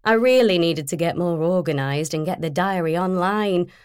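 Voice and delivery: ordinary delivery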